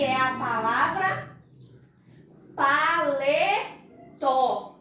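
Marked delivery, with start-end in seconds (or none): 2.6-4.8 "paletó" very slowly, pa, le, tó; syllable by syllable. Shown as a demonstration. Teacher presenting the word paletó, slow and divided by syllables. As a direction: draw out each syllable of "paletó". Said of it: "separar pra que a gente possa compreender melhor".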